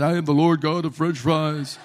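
booming, hammed-up divine proclamation; mock voice of God. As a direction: announce it loudly as a comic almighty being. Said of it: "oil started bubbling up and said".

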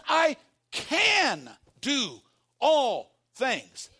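very slow, loud shout; shouted proclamation. Acted. Preacher shouting the verse to the congregation, very slow. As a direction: stretch out every word in a shout.

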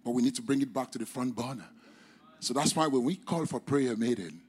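ordinary delivery

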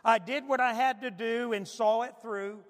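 dramatic recitation of song lyrics. Retold or imitated. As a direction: read the lyrics out like a poem, with feeling. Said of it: "I ran this off, [name]'s My Way lyrics".

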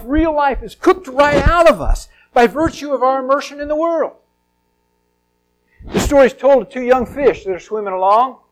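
usual speaking voice

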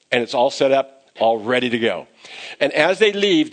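ordinary talk, nothing marked

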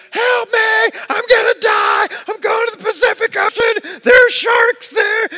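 very high, loud mock-terrified screams; hammed-up panicked shriek; panicked screaming. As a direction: reenact a child screaming for help. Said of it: "I started screaming to my buddy".

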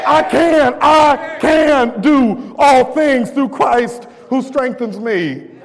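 shouted, slow and high declaration; loud declaration. Shown as a demonstration. Preacher shows the men how to declare it, shouting. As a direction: shout it so the devil hears. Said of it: "say it like you want the devil to hear you".

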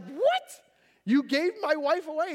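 high-pitched, incredulous outburst; hammed-up disbelief, pitch leaping up; shocked outrage. Samson to father-in-law who gave wife away, shocked.

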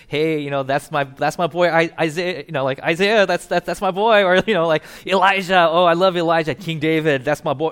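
loud, gushing fan raving about his heroes; excited fanboy. Retold or imitated. Peter home from school raving about favorite prophets, loud and excited. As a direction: hype your hero up like a starstruck kid. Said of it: "coming back from school saying, like".

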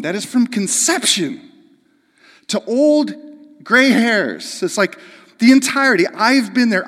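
high and loud, driving toward gray hairs; impassioned pulpit cry; building preaching shout. Real, not acted. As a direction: rise to a full-voiced climax. Preaching climax.

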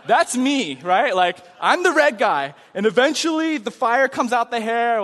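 ordinary talk

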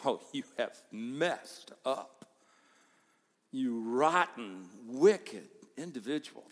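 slow, mock self-righteous scolding; mock condemning. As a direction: ham up a judgmental scold, drawn out.

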